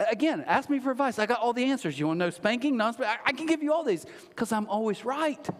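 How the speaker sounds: high-pitched, self-satisfied boasting; mock know-it-all. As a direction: act out someone sure of every answer. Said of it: "Now, we mock that".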